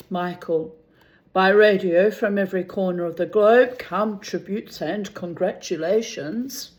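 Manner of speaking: ordinary talk, nothing marked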